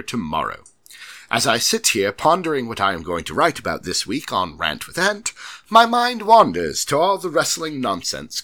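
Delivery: mock British accent, lively and emphatic; put-on British accent. Host reading Simon's email from Manchester, put-on British accent. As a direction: perform the fan's letter in a fake British accent. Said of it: "please forgive me for these accents".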